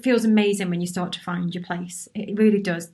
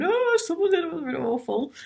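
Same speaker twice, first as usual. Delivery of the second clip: imitating her old tearful self, much higher, hammed-up; mock crying wail; 9 semitones higher than usual. Mocking how she once cried over critics, hammed-up wail. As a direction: wail it like a mock sob, then let it sag. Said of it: "I'd have been crying, going".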